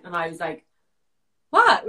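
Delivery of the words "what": sharp high shocked what; surprised what. Retold or imitated. Startled by the girls' news, a high shocked what. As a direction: blurt it out, startled.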